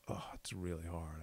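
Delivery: low, soft, defeated groan; imitating a quiet, worn-down moan; mock weary complaint. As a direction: sigh it out as if struggling. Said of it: "I could be like".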